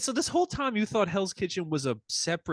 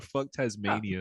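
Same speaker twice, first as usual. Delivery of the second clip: deadpan; 6 semitones lower, 5 dB quieter, slower than usual. Shown as a demonstration. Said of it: "That's the hot take for this episode".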